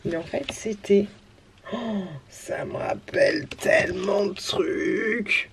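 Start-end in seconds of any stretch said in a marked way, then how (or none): 1.7-5.5 slow, breathy-soft whisper; slow hushed nostalgic whisper. Real, not acted. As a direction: whisper it, lost in memories. Nostalgic whisper over old cards.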